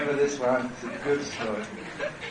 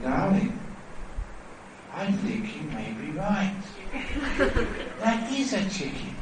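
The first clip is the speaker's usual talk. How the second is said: soft, tender giving in; gentle conceding; 5 semitones higher, 6 dB quieter than usual. Acted. Husband squeezing her hand and giving in, gentle conceding. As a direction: give way softly to a loved one. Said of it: "He squeezed her hand gently".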